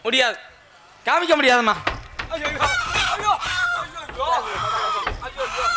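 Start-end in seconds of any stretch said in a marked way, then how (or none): 2.3-5.6 hammed-up sobbing lament; stage crying. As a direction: wail "ayyo" as if crying. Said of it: "அழுவாத, அழுவாத".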